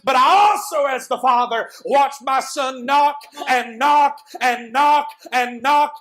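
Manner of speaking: building to a loud, ringing preacher's shout; full-force preaching. Acted. Father preaching to the congregation about his rejected son, building to a shout. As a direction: pound each line harder as the sermon peaks.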